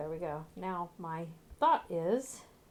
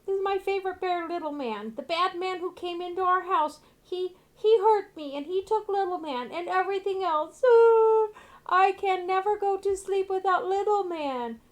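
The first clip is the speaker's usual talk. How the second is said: put-on high, loud pleading little boy voice; ghost boy; 11 semitones higher, 9 dB louder than usual. Ghost boy pleading to the party for his bear. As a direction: beg like a frightened child ghost.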